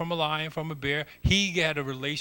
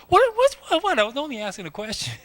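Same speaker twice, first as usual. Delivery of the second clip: put-on much higher, fast squeaky kid-brother whine; whiny little brother; 13 semitones higher, 4 dB louder, faster than usual. Little brother whining at his older brother, high and fast. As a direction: play the bratty little brother protesting innocence. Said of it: "Doesn't that sound like a little brother?".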